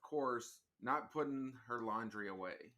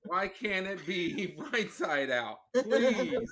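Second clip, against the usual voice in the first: exasperated plea; 5 semitones higher, 7 dB louder, slower than usual. Real, not acted. Complaining about inside-out laundry.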